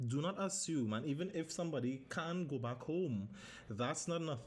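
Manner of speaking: ordinary talk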